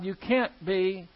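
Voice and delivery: normal talking voice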